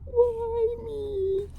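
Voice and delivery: high hammed-up sob; wolf weeping on a log; mock crying wolf. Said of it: "began to cry".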